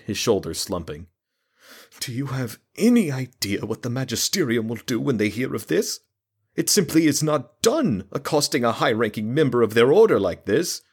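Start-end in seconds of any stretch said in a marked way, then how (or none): 2.0-10.8 a nervous town mayor frets, high and pleading; anxious mayor. A narrator's reading. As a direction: wring your hands and wail it out. Fretful mayor voiced in story.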